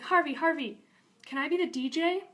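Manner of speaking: high, loud cartoon eagerness; eager pleading. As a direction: beg like an excited cartoon pal. Character eagerly begs in episode pitch.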